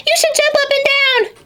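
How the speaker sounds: very high, fast bouncy voice; bouncy animal. Acted. An animal urging Jim to jump, high and fast. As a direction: bounce it out quick and squeaky.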